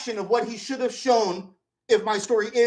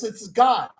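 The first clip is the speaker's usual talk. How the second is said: ordinary talk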